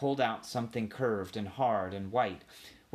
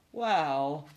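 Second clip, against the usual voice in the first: higher, hesitant opener; reluctant young mouse, pitched up; embarrassed; 5 semitones higher, 3 dB louder than usual. A narrator's reading. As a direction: sheepishly stall for time. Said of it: "embarrassed and ashamed".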